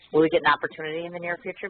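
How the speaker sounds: normal talking voice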